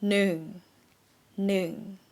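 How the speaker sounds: noon held level, no rise. Shown as a demonstration. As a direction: keep noon flat and steady. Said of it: "That's correct tone".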